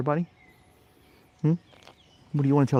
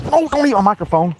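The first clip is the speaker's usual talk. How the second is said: higher and louder, a startled yelp at the dog; startled protest; 11 semitones higher, 6 dB louder than usual. Real, not acted. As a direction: stop the dog grabbing the mic. Dog grabs the microphone.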